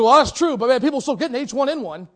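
loud, high, half-joking excuse; defensive retort. Retold or imitated. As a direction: brush it off loudly. Man's retort retold.

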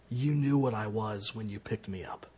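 low, soft, cold serpent voice; a calm, menacing snake; cold snake reply. Acted. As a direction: let the snake answer quietly and coldly.

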